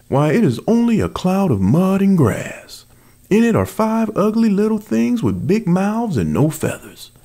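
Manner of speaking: high, loud mocking bear voice; shrill-pitched scornful outcry; bear crying out, high. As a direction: cry out in scorn at the wren's nest. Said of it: "he cried to the wolf".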